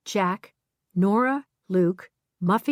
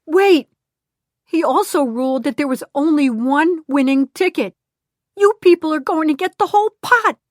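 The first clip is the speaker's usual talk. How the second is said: higher, louder urgent call of her name; crying out; 8 semitones higher, 6 dB louder than usual. Acted. Harvey on the phone calling Glenda, urgent cry. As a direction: grab her before she hangs up.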